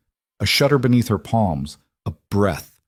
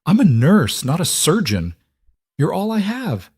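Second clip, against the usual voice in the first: panicked; 9 semitones higher than usual. Acted. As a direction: voice climbing in desperation. Nurse panicking during bullet surgery.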